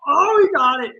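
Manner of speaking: high, loud cry at the catch; excited shout. Real, not acted. Streamer watching a player make the catch, high excited yell.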